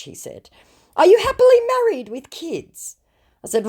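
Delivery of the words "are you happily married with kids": high, loud mock scammer, much higher; a smarmy scammer fishing for info, voiced high. Reading scammer's flirty question aloud.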